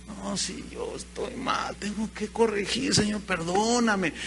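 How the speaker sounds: soft, half-whispered, slow confession; a believer confessing to the Lord, soft and contrite. Retold or imitated. Repentant believer confesses to God.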